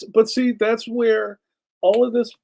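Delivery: ordinary delivery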